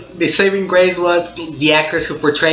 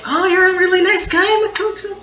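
gushing fan voice. Pretend. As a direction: pitch way up, sweet and gushing. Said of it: "I'll be like".

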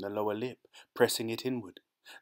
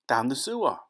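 higher, impatient retort; irritated answer; 4 semitones higher, 4 dB louder than usual. Acted. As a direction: state the obvious impatiently. Claude's irritated answer, echoed in triumph.

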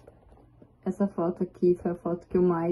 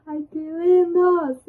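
much higher, slow gushing exclamation; gushing delight; 9 semitones higher, 4 dB louder, much slower than usual. Real, not acted. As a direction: swoon over the family photo. Sees family photo.

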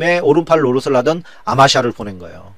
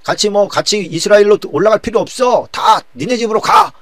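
rabble-rousing; 8 semitones higher, 4 dB louder than usual. Acted. Sheba urging Israelites to abandon David, rabble-rousing call. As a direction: send the crowd off with a forceful cry. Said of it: "북이스라엘 사람들을 선동하기 시작합니다".

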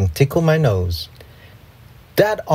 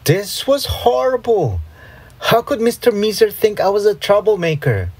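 dismayed; 9 semitones higher than usual. A narrator's reading. Narrator dismayed at being blamed.

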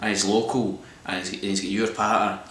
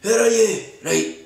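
mimicking a guy yelling into his phone, pitch jumping way up; hammed-up caller shouting where are you; mock phone call; 9 semitones higher than usual. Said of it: "he's like".

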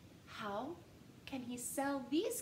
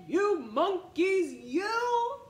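loud slow scolding; angry peddler shouting at monkeys; 5 semitones higher, 7 dB louder, much slower than usual. Acted. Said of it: "made the peddler angry".

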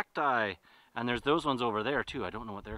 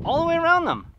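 higher and louder, stressing around; excited emphasis; 13 semitones higher, 8 dB louder, much faster than usual. Real, not acted. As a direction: marvel at how far they walked. Repeats phrase emphatically.

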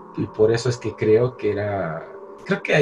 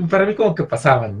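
normal talking voice

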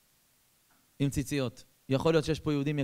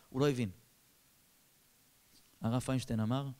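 ordinary delivery

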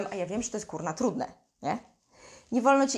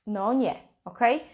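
usual speaking voice

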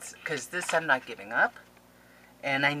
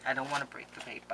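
soft, quick aside; hushed, hurried concern; 7 dB quieter, faster than usual. Real, not acted. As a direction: say it quickly under the voice. Same words, low then cried out.

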